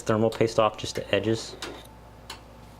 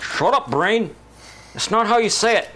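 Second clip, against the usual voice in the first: higher-pitched comic exasperation; mock scolding; 8 semitones higher, 3 dB louder than usual. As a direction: tell off your own brain in mock annoyance. Scolding own brain.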